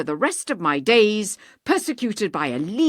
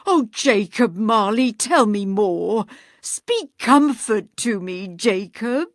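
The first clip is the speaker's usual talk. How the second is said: imploring; 2 semitones higher, 8 dB louder than usual. Acted. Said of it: "he said imploringly".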